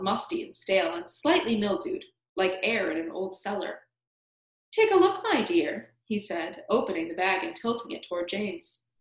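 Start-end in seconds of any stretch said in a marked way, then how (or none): 4.7-5.9 high start dropping on the endearment; coaxing, creaky old stranger; creaky old man. A narrator's reading. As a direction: invite a peek in an old man's voice. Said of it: "very slow and creaky".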